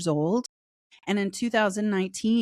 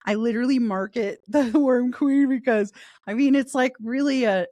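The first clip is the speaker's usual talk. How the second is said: laughing; 5 semitones higher, 4 dB louder than usual. Real, not acted. Laughing about her Worm Queen title.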